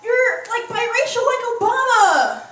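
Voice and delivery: put-on high, loud, slow gushing valley-girl gasp. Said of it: "people are like".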